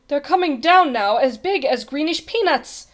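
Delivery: anxious warning. A narrator's reading. Page boy warns king of falling blobs.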